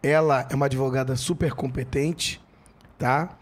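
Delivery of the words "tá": normal talking voice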